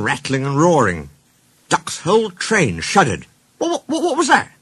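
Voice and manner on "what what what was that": high, stammering alarm; startled. Acted. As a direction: stumble over the first word, startled, pitch jumping up. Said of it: "he asked, startled".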